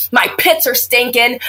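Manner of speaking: ordinary delivery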